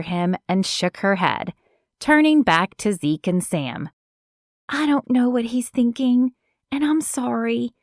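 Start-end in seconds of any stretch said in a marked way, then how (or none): 4.7-7.7 sorry and hopeful, higher; apologetic young woman. Acted. Kiki apologizes, voiced higher.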